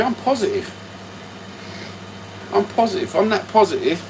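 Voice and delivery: sarcastic high chirp of positivity; squeaky mock cheer. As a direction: voice jumps high with forced positivity. Insisting he is not angry.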